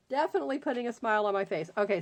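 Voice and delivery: normal talking voice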